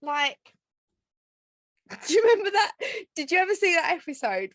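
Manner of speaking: ordinary talk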